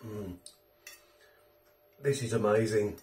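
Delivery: talking between bites; chewing while murmuring approval; mouth full chewing. Real, not acted. Seen 2: lips pressed, cheeks working after forkful; plate and fork in hand.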